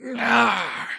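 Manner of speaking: high mocking goblin screech; goblin cry. Goblin mocking the crew as it strikes, high screech. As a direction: cackle it like a goblin.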